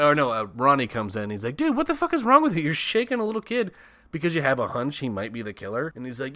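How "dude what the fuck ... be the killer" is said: high, loud indignant yell; outraged scold. Retold or imitated. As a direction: call him out in disbelief. Ronnie scolding Mel.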